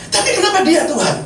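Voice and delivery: retold high-pitched protest, falling on the last word; angry at God. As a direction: plead back at God, starting high. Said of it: "Marah sama Tuhan saya".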